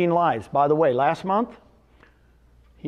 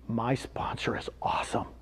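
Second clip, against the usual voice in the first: whisper; 7 semitones lower, 9 dB quieter than usual. Retold or imitated. Retelling Brent's tearful thanks.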